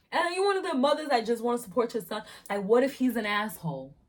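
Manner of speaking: normal talking voice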